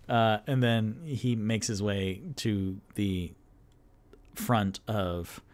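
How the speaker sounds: ordinary delivery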